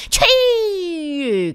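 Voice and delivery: high, loud, drawn-out yell; shrill outcry. A narrator's reading. Devil king hears his mother's nose, loud outcry. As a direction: cry out long and high. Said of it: "kiáltott az ördögök királya".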